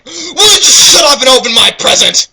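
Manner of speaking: high, loud snapping demand; hammed-up loud yell; shouted demand. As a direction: shout it like an impatient kid. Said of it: "Shouted Jackal".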